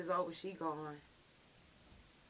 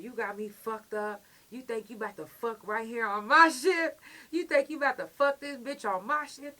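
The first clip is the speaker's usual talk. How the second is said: mock angry officer; 3 semitones higher, 7 dB louder than usual. Officer scolding, rising.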